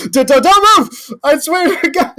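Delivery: high, frantic threat; panicked screaming. Acted. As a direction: scream it like a jumpy archer holding aim. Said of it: "they're all screaming".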